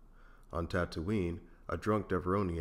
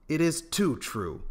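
higher, louder, slow indignant insistence; righteous; 9 semitones higher, 5 dB louder, slower than usual. A narrator's reading. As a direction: insist on it, wounded pride. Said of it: "said Han righteously".

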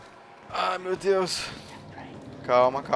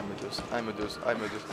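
normal talking voice

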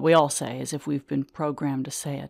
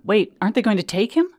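alarmed question; 6 semitones higher, 6 dB louder, faster than usual. Retold or imitated. Paramedics walking away.

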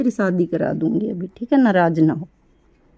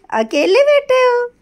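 high, drawn-out coo; 4 dB louder, slower than usual. Real, not acted. Owner greeting her sulking pet, high sing-song baby talk.

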